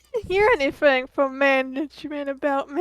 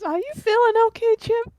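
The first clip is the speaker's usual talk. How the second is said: higher and quicker, squinting with concern; concerned NPC voice; 7 semitones higher than usual. Acted. Archie squinting and asking Chip if he's okay, higher and quick. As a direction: ask it as a worried, squinting coworker.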